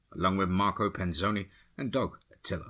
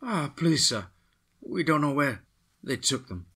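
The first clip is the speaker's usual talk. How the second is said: put-on higher, begging in a Latin accent; pleading woman; 7 semitones higher than usual. A narrator's reading. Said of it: "And she responded".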